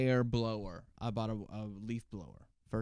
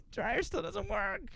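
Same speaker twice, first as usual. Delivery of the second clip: slow, much higher mocking repeat; 11 semitones higher, slower than usual. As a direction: whine it slowly up high. Repeats in mock voice.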